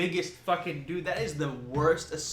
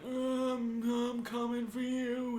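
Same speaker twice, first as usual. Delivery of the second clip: a hint of a creepy old lady, slow; slow creepy taunt from under the bed; lightly voiced grandma haunting her grandson; spooky grandma; 7 semitones higher, much slower than usual. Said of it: "his grandma's under the bed fucking with him".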